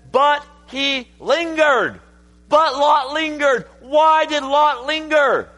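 high, loud sermon building in force; full-force preaching. Real, not acted. Preacher asking why Lot lingered, building full-force preaching.

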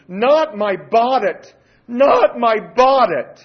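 put-on loud, slow, high wailing plea of a little girl; pleading child's cry. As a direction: cry it out like a child begging not to be spanked. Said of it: "She said this loudly at the top of her lungs".

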